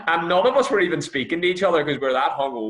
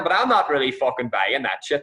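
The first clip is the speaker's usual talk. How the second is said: ordinary talk, nothing marked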